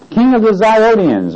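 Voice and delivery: "Ziotians" picked out in a high-pitched scripture reading; stress on Ziotians. Real, not acted. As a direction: read it out high, leaning firmly on "Ziotians". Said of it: "I'm gonna emphasize the word Ziotians".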